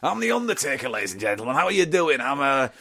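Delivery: high, sing-song mock northern English accent; hammed-up northern wrestler greeting the crowd; northern Undertaker impression. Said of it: "he'd be a northerner".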